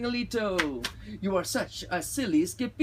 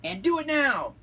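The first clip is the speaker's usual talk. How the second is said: high, loud motherly command; ordered; 11 semitones higher, 6 dB louder than usual. A narrator's reading. Mama orders her kitty boy, high and loud. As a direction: give the order sharply, pitch way up. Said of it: "ordered Mama".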